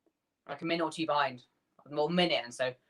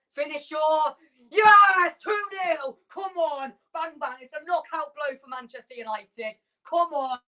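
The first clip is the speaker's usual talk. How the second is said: screaming yes, much higher and louder; goal celebration; 9 semitones higher, 4 dB louder than usual. Real, not acted. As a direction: erupt as the ball hits the net. Goal scored for his team.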